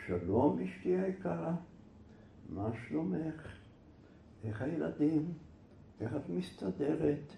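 soft, lilting tender delivery; gentle, melodious reading. Acted. Neighbour reads husband's letter to calm wife, tender singsong. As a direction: read each phrase soothingly, with little pauses. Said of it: "וכל מילה ככה עם מנגינה עושה לה".

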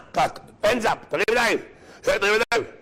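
high, shouted arrest command; hands up order. Retold or imitated. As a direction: bark it like soldiers storming in. Soldiers arrest the driver.

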